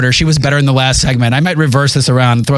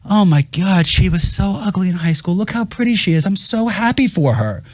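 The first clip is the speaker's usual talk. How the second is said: higher, softer mock-gushing voice; gushing fake delight; 6 semitones higher, 3 dB quieter than usual. Mimicking a social media scroller praising a pretty classmate, mock gushing. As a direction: gush sweetly like a friend cheering online.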